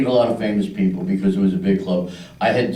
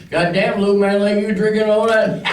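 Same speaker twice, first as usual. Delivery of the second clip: a trucker at the bar ribbing a small drinker; big cowboy trucker; 11 semitones higher than usual. Retold or imitated. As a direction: needle the little guy like a rowdy trucker. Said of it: "big cowboy trucker sits down next to me and goes".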